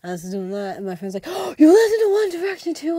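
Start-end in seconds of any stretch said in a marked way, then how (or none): 1.3-3.0 much higher, louder mock friend voice; teasing friend. Classmate catching her humming a song, teasing in a high squeal.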